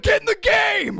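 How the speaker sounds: high, loud shouting sports dad; rec sports dad. Retold or imitated. Co-host mocks friend as rec sports dad, shouting. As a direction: holler it like an overinvested coach. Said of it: "like, rec sports dad".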